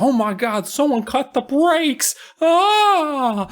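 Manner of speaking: high, loud terrified yells; panicked screaming. Acted. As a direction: scream as the ride goes wild. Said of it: "[name]'s just like".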